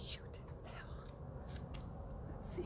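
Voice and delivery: hushed annoyed whisper; whispered curse. Real, not acted. Hanging a crystal in the dark, whispering. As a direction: curse under your breath.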